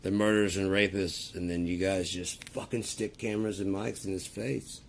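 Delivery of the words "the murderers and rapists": louder quoted campaign line; mock Trump line. Imitating Trump at a rally, mock bluster. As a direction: ham up a rally slogan. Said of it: "he just got out there and goes".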